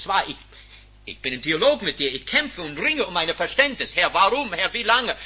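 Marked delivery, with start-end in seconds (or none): 4.0-5.1 raised, higher plea to God; crying out like a prophet. Acted. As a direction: cry out the questions to heaven. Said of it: "Wie die Propheten rufen".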